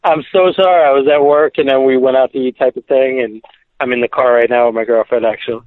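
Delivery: distant caller on speakerphone; phone voice. Real, not acted. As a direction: talk from a car through a phone. Caller heard over speakerphone.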